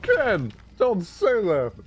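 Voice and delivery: high mock yell of protest. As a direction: squeal it high like a scandalised friend. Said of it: "Razor Cat is like".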